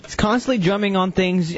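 ordinary talk

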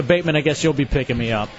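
ordinary talk

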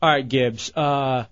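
ordinary talk

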